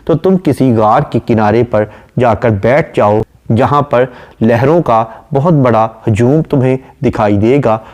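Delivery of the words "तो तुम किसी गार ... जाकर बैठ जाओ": normal talking voice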